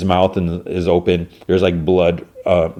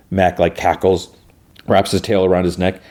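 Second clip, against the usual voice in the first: ordinary talk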